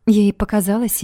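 ordinary delivery, nothing marked

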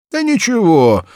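put-on high, loud, blustering old peasant; gruff peasant Ignat. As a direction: brush it off loudly like a grumbling farmer. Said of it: "ответил Игнат".

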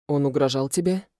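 ordinary delivery

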